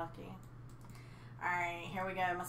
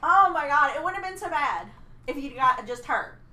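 mock outrage; 7 semitones higher, 12 dB louder than usual. Mock outrage at his luck.

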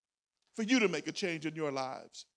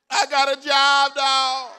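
loud, higher-pitched comic proclamation; mock-indignant shout; 4 semitones higher, 10 dB louder, slower than usual. [name] answers the joke about not having a job, comic shout. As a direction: belt it out as a punchline to the crowd.

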